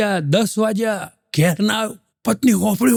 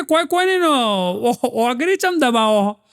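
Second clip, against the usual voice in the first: high-pitched puzzled wife; a lightly voiced wife asking why, pitched up high; wife's voice; 4 semitones higher than usual. Husband offers to press her finger, wife asks why, pitched high. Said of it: "પત્ની કે".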